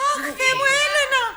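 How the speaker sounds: high, loud delighted cry with a laugh; joyful cry. Acted. Sofia greeting Elena after years, a high joyful cry. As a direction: greet her like a long-lost friend. Reunion with old friend.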